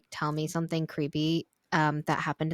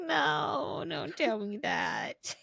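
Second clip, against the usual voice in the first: mock dread; 7 semitones higher, 4 dB quieter, much slower than usual. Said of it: "It's like".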